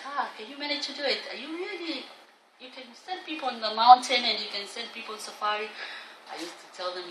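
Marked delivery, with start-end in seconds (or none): 0.1-5.7 high, incredulous questioning; a doubting voice asking if she really can; surprised. Retold or imitated. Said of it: "a lot of people used to have a surprise".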